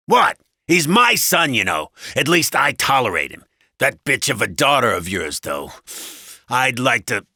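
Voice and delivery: loud, exasperated husband's tirade; angry husband. A narrator's reading. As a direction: rant like a bullying husband.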